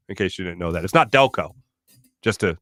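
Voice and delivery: ordinary delivery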